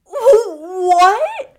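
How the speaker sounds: high, loud, laughing disbelief; shocked laugh. Real, not acted. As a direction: cry out through laughter.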